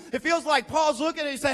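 usual speaking voice

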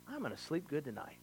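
soft, quick sighed aside; relieved murmur to the congregation; sighing aside. Real, not acted. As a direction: sigh it out under your breath. Aside after sermon line, sigh.

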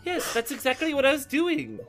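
laughing. Real, not acted. Laughing, claims the accent.

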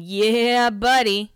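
lightly imitated party-guy catchphrase; playful mock cheer; Steven's catchphrase. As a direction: draw out the catchphrase a little. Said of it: "by a Steven saying".

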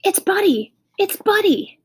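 high, loud squeal of recognition; excited girl. A narrator's reading. Girl finds her lost dog, excited cry. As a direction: cry out in high-pitched delight. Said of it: "in an excited way".

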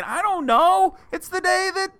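high goofy dim-witted voice, pitched well up; playing dumb. Host playing dumb about the Fourth, high goofy voice. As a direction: play the clueless fool with a high silly voice. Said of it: "I'm kind of dumb and dim-witted".

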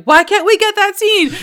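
loud, high-pitched playful protest; hammed-up yell of a cheated viewer; mock outraged fan. Fan protesting the cut to commercial, mock outraged.

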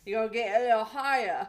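loud imitation Australian accent; hammed-up Australian accent. Shown as a demonstration. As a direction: belt out a put-on Aussie accent. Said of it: "Okay, there you go".

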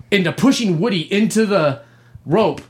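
usual speaking voice

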